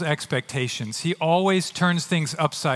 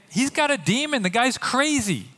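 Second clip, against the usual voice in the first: higher-pitched, lightly acted dismissal; mock accusing; 7 semitones higher than usual. People of his day dismissing John, mock accusing. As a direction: write him off like a scoffing crowd.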